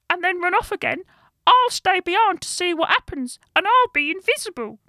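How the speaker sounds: very high, loud squeaky creature; brownie voice. A narrator's reading. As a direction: lay out the scheme in a tiny creature's pitch. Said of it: "said the brownie".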